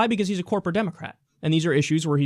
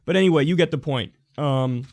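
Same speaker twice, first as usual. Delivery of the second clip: usual speaking voice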